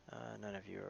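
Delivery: usual speaking voice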